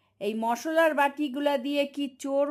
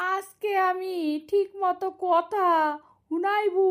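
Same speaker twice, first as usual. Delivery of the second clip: ordinary delivery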